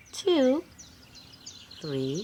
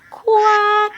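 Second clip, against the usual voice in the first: loud high quack; duck quack; 13 semitones higher, 10 dB louder than usual. Acted. Reading to kids, a loud duck quack.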